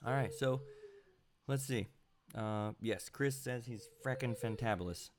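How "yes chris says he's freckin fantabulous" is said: ordinary talk, nothing marked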